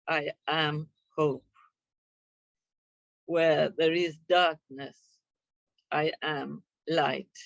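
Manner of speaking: slow, solemn reading with long pauses; measured prayer read with pauses between lines. Real, not acted. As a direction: read the prayer slowly, letting each line settle. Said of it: "I will read it slowly".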